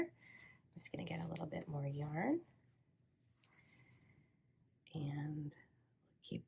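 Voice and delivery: whispered aside to herself; whispering. Real, not acted. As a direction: murmur it under your breath, unvoiced. Quiet aside while working.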